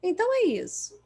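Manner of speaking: sly provoking question; provocative tone. Shown as a demonstration. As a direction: needle the client with it. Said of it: "vem com um tom de provocação".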